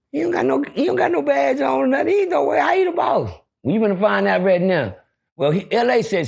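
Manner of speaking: usual speaking voice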